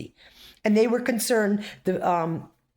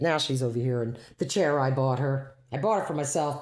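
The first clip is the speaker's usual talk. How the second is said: usual speaking voice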